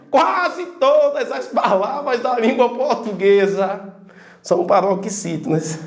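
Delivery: ordinary talk, nothing marked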